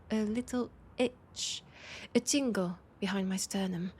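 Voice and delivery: usual speaking voice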